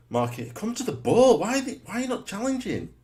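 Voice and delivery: high, loud call at the players; exasperated yelling at the screen; frustrated yell. Real, not acted. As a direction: shout at them to go for the ball.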